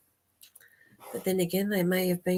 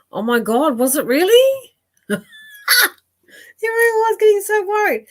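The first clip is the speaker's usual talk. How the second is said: much higher, louder burst of relief; relieved excitement; 13 semitones higher, 9 dB louder than usual. Real, not acted. Psychic to viewer, relieved: excited higher louder gush.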